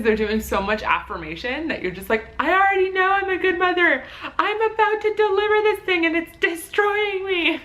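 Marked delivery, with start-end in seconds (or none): 2.4-7.6 very high, loud comic complaint; hammed-up protest pitched way up; mock exasperated wail. Mother in labour answers the affirmations, comically exasperated.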